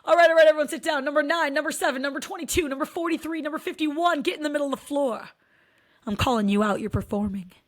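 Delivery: put-on dance teacher barking orders at the kids; dance teacher calling out. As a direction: bark out the numbers like a strict dance teacher. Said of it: "they go".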